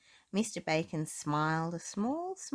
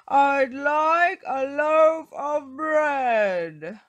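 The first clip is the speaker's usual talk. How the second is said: slow, loud, higher shout; bellowing for bread; 9 semitones higher, 12 dB louder, much slower than usual. Acted. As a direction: shout it, drawn out. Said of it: "Mister Noisy shouted".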